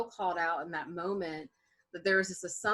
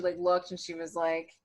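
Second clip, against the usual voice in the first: ordinary delivery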